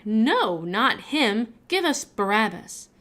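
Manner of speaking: loud crowd yell of refusal; crowd shouting back. A narrator's reading. Crowd shouting back at Pilate, loud demand. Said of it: "They shouted back".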